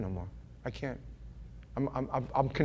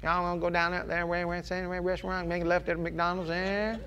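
put-on Southern accent; 2 semitones higher, 6 dB quieter than usual. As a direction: imitate an unintelligible Georgia Southerner giving directions. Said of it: "they have a real Southern accent".

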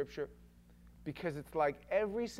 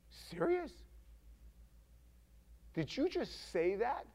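mock disbelief, pitched up on the first word; astonished; 3 semitones higher, 6 dB quieter than usual. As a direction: act out being stunned, with pauses between questions. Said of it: "You see that word for astonished?".

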